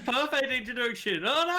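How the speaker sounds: high, laughing through the words; cracking up mid-sentence. Real, not acted. Laughing at guest's chip mouth.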